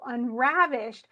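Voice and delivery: unravished, slowly, RAV stretched out; stress on RAV. Shown as a demonstration. Teacher showing where stresses fall, unravished with stress on RAV. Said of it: "you can hear me kind of stress it".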